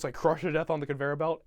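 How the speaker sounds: ordinary talk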